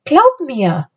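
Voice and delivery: high-pitched, firm assurance; insistent. A narrator's reading. Anne pressing her brother to believe, insistent. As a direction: insist with a lifted voice. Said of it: "beharrte Anne".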